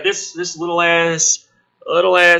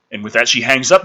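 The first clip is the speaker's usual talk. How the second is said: ordinary talk, nothing marked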